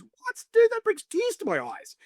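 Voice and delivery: hammed-up, drawn-out disbelief; mock shocked outburst. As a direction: imitate a mate overwhelmed by heat. Mate's shocked reaction to hotter sauce.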